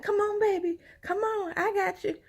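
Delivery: put-on high, eager little-girl voice; little girl coaxing. Little Chunky coaxing the baby down the slide, high and eager. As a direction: coax the baby like a proud little kid.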